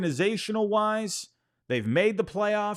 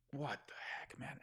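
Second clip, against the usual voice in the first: whisper; 4 semitones lower, 22 dB quieter than usual. Real, not acted. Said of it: "whispered aside".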